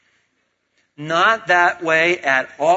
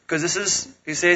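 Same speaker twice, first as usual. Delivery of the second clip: usual speaking voice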